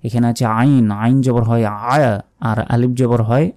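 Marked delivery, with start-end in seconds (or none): none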